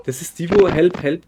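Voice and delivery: loud excited recognition. Real, not acted. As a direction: blurt it out, thrilled. Recognises headless figure.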